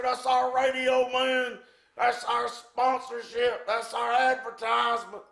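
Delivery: acting out an outraged, high-pitched list; exasperated high yell. Promoter listing what Butcher cost him, exasperated yell. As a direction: hammer each loss home, voice held high.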